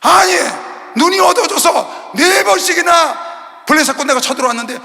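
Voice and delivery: shouted rebuke to the congregation; fiery preaching. Acted. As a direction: thunder it from the pulpit. Preaching at full force.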